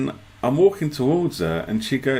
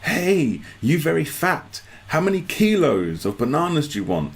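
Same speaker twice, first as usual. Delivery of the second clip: higher, bright imitation of a friendly banana seller; cheerful vendor; 6 semitones higher than usual. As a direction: play the grinning vendor calling out. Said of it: "this guy comes running out".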